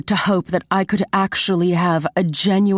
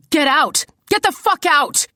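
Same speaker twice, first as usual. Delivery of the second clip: much higher, furious demand; increasingly intense; 11 semitones higher than usual. A narrator's reading. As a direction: escalate to a high-pitched outburst. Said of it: "with an increasing intensity".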